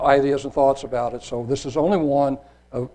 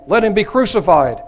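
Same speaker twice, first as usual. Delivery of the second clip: raised, forceful reading; crowd's demand; 7 semitones higher, 8 dB louder than usual. A narrator's reading. Crowd answers Pilate, demanding loudly. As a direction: deliver it as a loud, high demand. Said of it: "They all said unto him".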